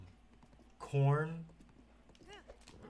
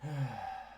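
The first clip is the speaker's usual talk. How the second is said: falling, soft sigh; wordless fed-up sigh; weary sigh; 4 dB quieter than usual. Real, not acted. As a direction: let out a tired exhale. Fed up after losing.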